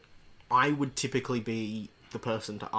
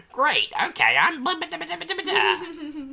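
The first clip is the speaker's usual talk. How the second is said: in-character voice; 7 dB louder than usual. Acted. Switching into in-character gibberish.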